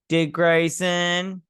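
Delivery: high, very slow name-call; drawn-out greeting. Retold or imitated. Sheriff greeting his visitor by name, drawn out. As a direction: stretch the name out with relish.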